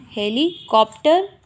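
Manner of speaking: "Helicopter" slowly, broken into heli and copter; slow dictation. Shown as a demonstration. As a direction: dictate "helicopter" in two parts with a pause. Repeats word slowly while writing. ("Helicopter" धीरे-धीरे, दो हिस्सों में तोड़कर)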